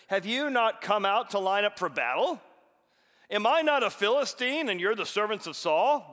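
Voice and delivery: loud, taunting battle cry; Goliath crying out. Acted. Giant taunting the army of Israel, booming shout. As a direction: shout a challenge across the valley. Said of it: "he stood and cried out".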